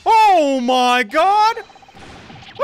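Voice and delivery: long, high, loud drawn-out scream; shrieking as he watches the man fall; horrified scream. Acted. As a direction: scream it out in horror. Character falls from the watchtower.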